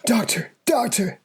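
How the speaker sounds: high-pitched cry for the doctor; alarmed calling out. Acted. Character calls to the fallen doctor, high alarmed call.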